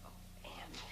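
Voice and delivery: breathy whispered sigh. Acted. Whispered apology.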